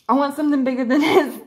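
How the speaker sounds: giggling while raising the voice; laughing. Real, not acted. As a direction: laugh through the wish for a bigger ring.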